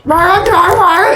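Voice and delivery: loud, high-pitched roaring growl; monster growl. Acted. Creature trapped in a box, loud growly roaring. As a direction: roar wildly in bursts. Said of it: "growly sounds".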